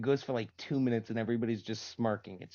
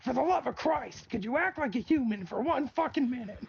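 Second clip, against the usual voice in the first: much higher, louder mock outburst of a fed-up teacher; exasperated teacher yell; 6 dB louder than usual. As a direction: blow up like a teacher at the end of his rope. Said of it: "he was a teacher and went".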